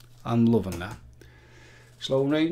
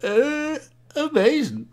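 much higher, hammed-up presenter voice; a deliberately terrible presenter "amazing"; presenter impression; 5 dB louder, slower than usual. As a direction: badly imitate the TV presenter. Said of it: "terrible impression of that presenter".

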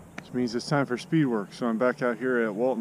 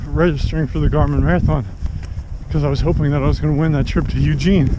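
loud, slightly breathless while running; 2 semitones higher, 7 dB louder than usual. Real, not acted. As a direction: talk over your stride. Talking while doing speed work.

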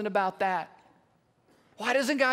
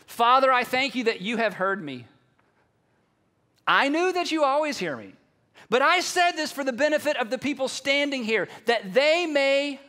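raised, declaimed public prayer; Jesus; 3 semitones higher, 3 dB louder than usual. Acted. Jesus praying before people standing at the tomb, declaimed prayer. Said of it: "Listen how Jesus prayed this".

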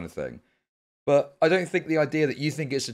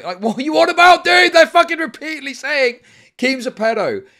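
much higher and louder, real frustration; exasperated outburst; 13 semitones higher, 12 dB louder than usual. Real, not acted. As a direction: shout it at chat in disbelief.

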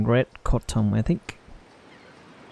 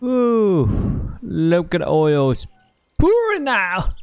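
much higher, slow excited voice; squealing with glee at oil; delighted squeal; 9 semitones higher, slower than usual. Real, not acted.

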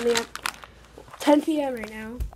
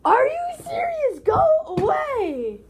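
crying shout; 10 semitones higher than usual. Real, not acted. Kid yelling at sister to go away, high tearful shout. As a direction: shout through tears.

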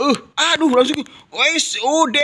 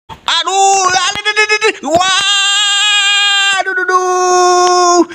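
anguished cry; 7 semitones higher, slower than usual. Acted. Anguish at a conceded goal.

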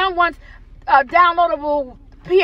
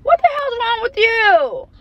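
imitating scolding; 9 semitones higher, slower than usual. Retelling scolding daughter.

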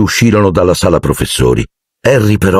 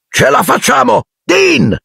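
high-pitched call to the next pupil; rally them, then call the name high; urgent rallying cry. A narrator's reading. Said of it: "gridò Lupin". (grido acuto d'incitamento)

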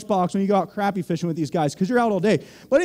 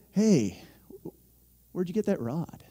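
conspiratorial whisper; 3 semitones lower, 8 dB quieter than usual. Retold or imitated. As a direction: whisper it, as if asking a secret. Sneaky ask about fishing gear.

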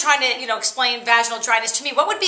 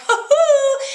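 high, loud laughing whoop; gloating whoop; 6 dB louder than usual. Retold or imitated. Beating her sisters' gifts, a gloating whoop. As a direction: whoop in triumph over the sisters. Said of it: "And I'm like".

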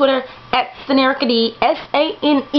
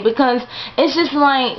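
ordinary delivery

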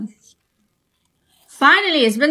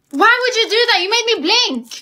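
upset high protest; 8 semitones higher than usual. Acted. Friend tricked her into blinking.

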